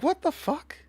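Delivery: high, squeaky disbelief; mocking Emu. Streamer imitates Emu's face at Jet Gatling, high and baffled. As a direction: squeak it out, stunned. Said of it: "Emu's like".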